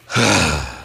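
long, low heavy sigh; imitated scornful sigh. Kid at the men's talk, imitated with a disgusted sigh. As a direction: exhale hard, mimicking a fed-up listener. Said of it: "he's just like".

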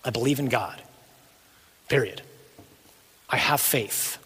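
low, softened statement of faith; quiet declaration. Acted. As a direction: declare it quietly and firmly, with long pauses. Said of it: "I declare in front of hell".

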